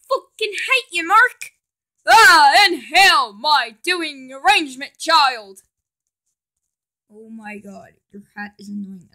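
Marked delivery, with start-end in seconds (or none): 0.1-3.3 high, loud furious shouting; angry yell. Acted. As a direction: explode at him. Angry outburst.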